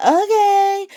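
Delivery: very high mock okay; high-pitched humoring. Felicia answering Anna's wild rant, high-pitched humoring. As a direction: humor them with a squeaky-high agreement. Acting out Felicia's doubtful reply.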